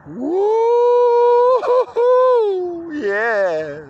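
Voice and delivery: high rising woo-hoo, then a long yeah; celebratory holler; jubilant whoop. Real, not acted. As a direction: whoop it up high and let it fall. Whoops to cap the hype.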